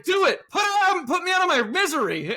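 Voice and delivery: loud theatrical plea, up high; mock pleading shout. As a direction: shout it high and loud, begging to be put out of misery. Mock begging in retold scene.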